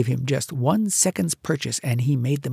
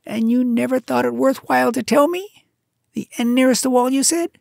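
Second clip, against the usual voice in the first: higher, louder impatient mongoose; urgent, fed-up mongoose; Rikki Tikki; 10 semitones higher, 5 dB louder than usual. A narrator's reading. Mongoose tells the bird to stop singing, impatient.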